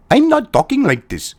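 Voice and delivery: put-on caricatured Indian accent; mock Indian accent. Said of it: "Him talking with an American accent".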